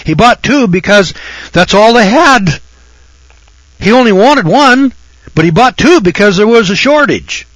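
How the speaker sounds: getting heated over the rice buyer; worked-up indignation. Real, not acted. Worked up about rice shortage.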